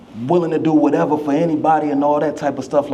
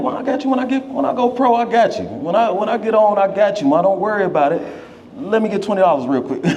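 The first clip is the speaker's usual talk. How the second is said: high, bright pleading son voice, joking; playful son to mom; 6 semitones higher than usual. Retold or imitated. Joking son to his mom, promising then asking money, high playful. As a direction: sweet-talk your mom, then ask for twenty.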